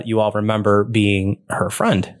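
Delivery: usual speaking voice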